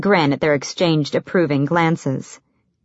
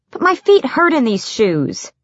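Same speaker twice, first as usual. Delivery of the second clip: higher, lightly plaintive protest; understated pout about sore feet; mild pitiful complaint; 7 semitones higher than usual. A narrator's reading. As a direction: complain with a touch of self-pity. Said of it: "She frowned pitifully".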